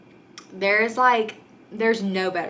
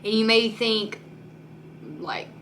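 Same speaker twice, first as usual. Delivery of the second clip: ordinary talk, nothing marked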